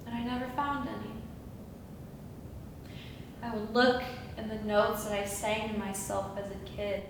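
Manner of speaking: low, soft, wounded recollection; subdued confession. Acted. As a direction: confess it quietly to an absent angel. Confesses failed childhood search for angels.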